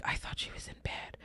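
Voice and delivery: hushed disbelief, whispered; whispered thought. Retold or imitated. Mother seeing her daughter walk in at night, whispered. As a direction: whisper it as if stunned. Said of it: "and I'm like".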